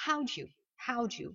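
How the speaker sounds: "how do you" run together as how-dju; contracted do you. Shown as a demonstration. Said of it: "faire la contraction avec do you". (« how do you » contracté en how-dju)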